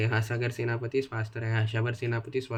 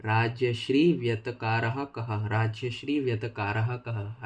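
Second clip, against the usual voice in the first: each syllable drawn out slowly for memorizing; slow recitation drill; much slower than usual. Shown as a demonstration. Teacher recites a Sanskrit line slowly for students to memorize. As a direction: recite it slowly so the class can follow. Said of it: "Harshvardhan बोल तो".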